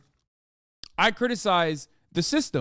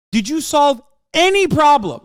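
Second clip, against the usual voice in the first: raised, louder exasperated demand; exasperated outburst; 8 semitones higher, 7 dB louder than usual. Real, not acted. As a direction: throw the question back at them, fed up. Exasperated rhetorical challenge.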